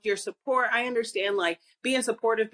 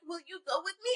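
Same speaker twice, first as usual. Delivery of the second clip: small, high, soft plea; needy boyfriend; 6 semitones higher, 9 dB quieter, faster than usual. Retold or imitated. Artist asking his girlfriend along to his exhibition, pleading. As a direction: beg softly in a pitched-up mock voice.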